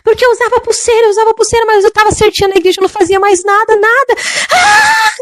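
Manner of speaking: high-pitched, hammering wail of a damned soul; acting out a woman's tormented screaming; imitated scream. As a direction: scream it out in desperation, ending in a piercing shriek. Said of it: "e ela gritou".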